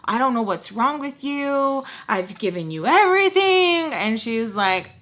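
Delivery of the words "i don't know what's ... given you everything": mock exasperated lament. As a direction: plead it, rising to a loud finish. Retold father's exasperated outburst.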